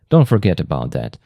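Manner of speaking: usual speaking voice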